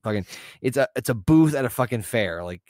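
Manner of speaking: ordinary talk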